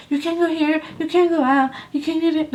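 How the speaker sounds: high-pitched nagging list; mock a jealous girlfriend laying down rules; controlling partner.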